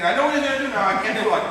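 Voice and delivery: high loud teasing; taunting. Retold or imitated. Rival taunting him to train, mocking. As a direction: needle him playfully. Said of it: "He's taunting me".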